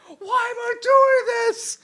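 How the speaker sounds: very high, slow, loud crying shout; panicked wail. Acted. Game master acting out the shooting scene, drawn-out panicked wail. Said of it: "She starts going".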